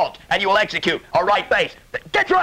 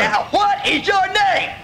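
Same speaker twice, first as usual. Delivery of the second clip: slow barked demand; 12 semitones higher, much slower than usual. Acted. Instructor demanding name.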